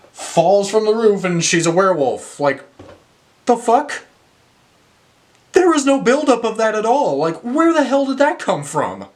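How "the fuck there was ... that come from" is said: higher, incredulous rant at a twist from nowhere; can't believe what the movie just did; exasperated disbelief. Real, not acted. Reviewer ranting at the werewolf twist, higher and incredulous.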